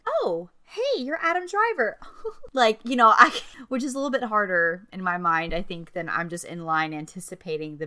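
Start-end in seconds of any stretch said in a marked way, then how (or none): 0.1-2.0 high startled greeting, mock starstruck; acted surprise. Bumping into an actor by accident, acted surprise. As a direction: blurt a high, caught-off-guard hello. Acting out meeting a celebrity.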